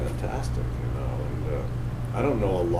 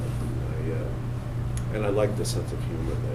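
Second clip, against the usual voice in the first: ordinary talk, nothing marked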